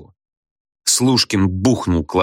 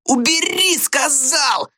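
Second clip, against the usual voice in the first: very high, strained demand; Gradusov yelling; slower than usual. A narrator's reading. Pupil demands teacher let go his ear, yelling. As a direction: shriek the order, rolling it out. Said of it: "заорал Градусов".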